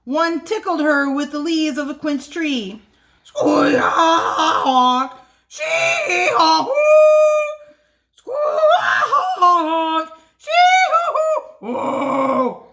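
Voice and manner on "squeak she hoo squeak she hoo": 大きないびき; loud snoring. Acted. Dragon princess snoring.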